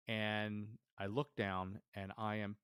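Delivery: ordinary talk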